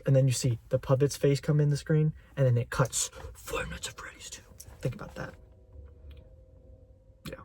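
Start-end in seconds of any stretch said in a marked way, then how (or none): 3.3-4.4 dramatic whisper. Acted. Dramatic title reveal. 4.8-7.3 whisper. Acted. Dramatic aside after reveal.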